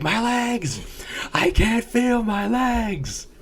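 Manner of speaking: high, slow anguished yell; shouting while wounded. Retold or imitated. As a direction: cry out from a broken back. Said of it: "the guy's like".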